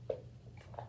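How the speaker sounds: whispering the count under breath; whispered counting. Real, not acted.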